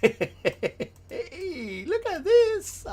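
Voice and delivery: very high, laughing squeal; overjoyed squeal. Real, not acted. Streamer showing off the discovery, high delighted squeal. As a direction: squeal it, close to tearful laughter.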